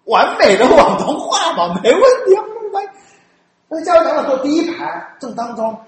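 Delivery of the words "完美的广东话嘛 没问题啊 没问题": mock high confident. Mocking perfect Cantonese claim.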